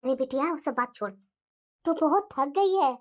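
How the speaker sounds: low, calm mother voice; mother calming her angry daughter, low and gentle; soothing mother. Acted.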